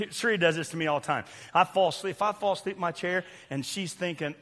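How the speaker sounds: ordinary talk, nothing marked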